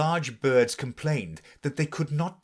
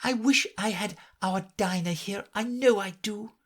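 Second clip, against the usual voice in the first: Alice aloud; 10 semitones higher than usual. A narrator's reading. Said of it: "said Alice aloud".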